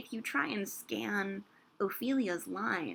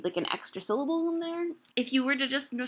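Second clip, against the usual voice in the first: ordinary delivery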